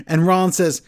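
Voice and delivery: ordinary talk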